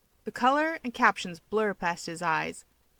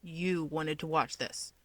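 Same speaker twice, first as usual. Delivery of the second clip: low, slow, softer warning; clipped warning under the breath; a touch of a snap; 4 semitones lower, 6 dB quieter, slower than usual. Acted. Toya snapping at Keigo over the show. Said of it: "Toya finally snaps".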